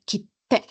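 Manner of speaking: "kite" cut into "kit...te"; short "ki", silent gap, "te"; doubled T in kite. Shown as a demonstration. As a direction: stop the sound before the T of "kite", then release. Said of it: "para que puedan identificar".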